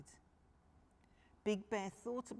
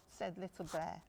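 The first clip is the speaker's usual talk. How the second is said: ordinary delivery, nothing marked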